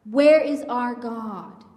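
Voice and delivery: ordinary talk, nothing marked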